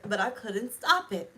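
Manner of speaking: ordinary talk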